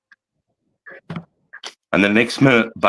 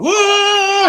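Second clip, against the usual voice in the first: high, far-off yelp of surprise; mock whoa. Soldier retelling his shock at the water. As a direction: yelp it out like a startled kid.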